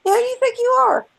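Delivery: high, scornful comeback; mock outrage, pitched high; indignant retort. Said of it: "I'm like".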